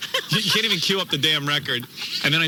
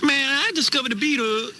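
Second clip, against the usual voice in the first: higher put-on street voice; mock homeboy bragging; hammed-up urban slang; 11 semitones higher than usual. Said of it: "you had to talk like this".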